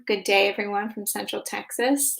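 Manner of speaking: ordinary delivery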